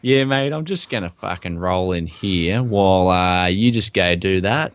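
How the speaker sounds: casual Australian drawl, lightly put on; a hint of an easygoing Australian bloke; laid-back Aussie mate. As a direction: talk like a relaxed Aussie mate. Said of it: "in Australia, it'd be like".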